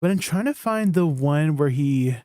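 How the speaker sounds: ordinary delivery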